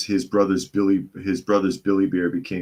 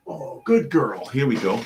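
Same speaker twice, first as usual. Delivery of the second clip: high sweet praise for the cat; 5 semitones higher than usual. Real, not acted. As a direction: coo at the cat.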